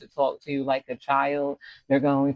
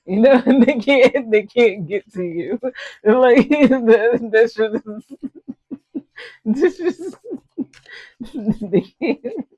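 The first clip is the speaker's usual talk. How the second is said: high, loud, breaking into giggles; laughing hard; 9 semitones higher, 8 dB louder than usual. Real, not acted. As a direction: try to speak through real helpless laughter. Cracks up at own impression.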